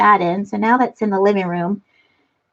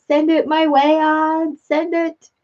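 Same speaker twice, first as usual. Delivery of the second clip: playful pleading; 6 semitones higher, slower than usual. Real, not acted. Begging aunt for sunshine.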